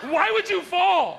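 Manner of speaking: high, loud, drawn-out demand; shrill-pitched angry shout; mad wife yelling. Acted. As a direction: scold at full volume like a furious wife. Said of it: "She gets mad at you".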